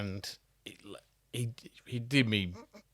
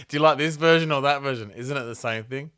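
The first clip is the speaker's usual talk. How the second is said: higher, louder, fast mock question; mock designer question; 5 semitones higher, 6 dB louder than usual. Mate teasing the designer, fast mock-fussy question.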